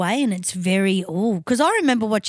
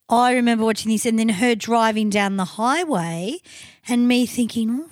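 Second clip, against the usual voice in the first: ordinary talk, nothing marked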